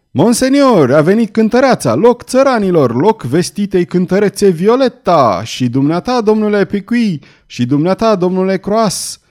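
loud, high showman's announcement; shouting. A narrator's reading. Villain announcing the singer Violeta to the crowd, shouting. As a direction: call out over the crowd to clear the way. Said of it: "coborâ treptele șubrede ale scăriței urlând".

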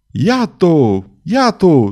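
booming accusing shout; thundering shout. A narrator's reading. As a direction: thunder it out, pointing her out. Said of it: "tunară Crousset și Pezu".

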